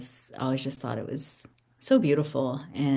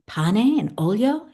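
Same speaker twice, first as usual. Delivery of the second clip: a lightly voiced uncle asking, higher and rising; a hint of the surprised uncle checking; uncle's question; 7 semitones higher, 5 dB louder, slower than usual. Uncle asking his niece about bread, lightly voiced. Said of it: "he asked".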